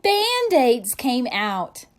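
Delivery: slow, loud reveal; spring the silly surprise; surprised reveal. A narrator's reading.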